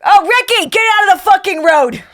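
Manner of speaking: put-on high, loud Boston-accented holler; Boston mom yelling. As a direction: imitate a mother yelling at her toddler. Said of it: "I saw a woman yell at her toddler in Boston".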